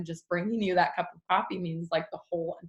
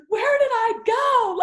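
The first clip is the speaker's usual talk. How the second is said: very high, loud, slow mock wail; lost new mom; 7 dB louder, slower than usual. New mom asking mamas where her old self went, slow high wail. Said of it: "mamas on here who are like".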